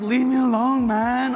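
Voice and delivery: loud, high mock drunk protest; Steve, drunken protest. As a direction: whine back as the drunk roommate being dragged off. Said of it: "He's literally just like".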